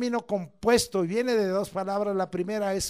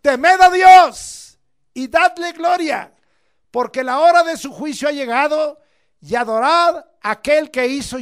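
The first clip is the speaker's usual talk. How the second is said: loud proclamation; 6 semitones higher, 12 dB louder than usual. Acted. Preacher booming the angel's call to all. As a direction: proclaim it at full voice to the nations. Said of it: "diciendo a gran voz".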